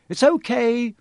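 put-on boy calling upward. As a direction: call up to the light above. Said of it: "he called up to the light".

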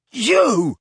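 put-on high, loud drunken bellow. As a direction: roar it out in drunken rage. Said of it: "he bellowed".

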